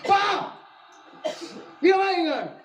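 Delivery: wife calls her husband home, playful mock yell. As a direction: holler for him, half in jest. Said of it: "Panggil begitu".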